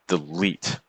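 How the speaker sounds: "delete" slowly, with the weight on "-lete"; light "de", long stressed "LETE"; iambic stress. Shown as a demonstration. Teacher giving students an iambic example word, second-syllable stress. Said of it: "some words that are iambic".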